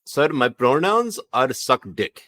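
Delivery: put-on Pakistani accent. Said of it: "talk like a Zoomer in a Pakistani accent".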